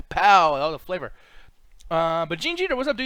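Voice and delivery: usual speaking voice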